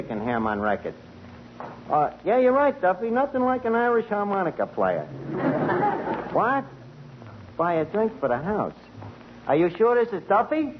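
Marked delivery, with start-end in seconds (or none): 5.1-6.3 breathy high chuckle into the phone; high laugh. Real, not acted.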